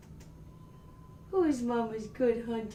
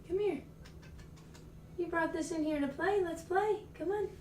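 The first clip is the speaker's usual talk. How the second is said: cat-coaxing voice; 8 semitones higher, 11 dB quieter than usual. Real, not acted.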